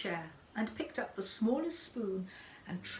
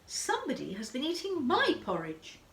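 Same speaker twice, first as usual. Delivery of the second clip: higher, lighter mother bear voice; lilting and higher, a gentler bear; 6 semitones higher, 4 dB louder than usual. A narrator's reading. Mother Bear noticing her chair was used, gentler voice. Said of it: "she said in her quiet voice".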